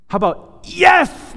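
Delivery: high, slow, fist-pump yes; excited yes. Acted. Pastor urging the congregation to cheer God, a high slow shout. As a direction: throw it out like a cheer at a game.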